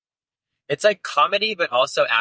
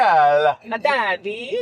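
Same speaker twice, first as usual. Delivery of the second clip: high, hammed-up fake Spanish accent; playful high-pitched Spanish impression; mock Spanish accent; 8 semitones higher, much slower than usual. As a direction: echo the joke in a put-on Spanish accent, pitched up. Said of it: "It's an authentic Spanish accent".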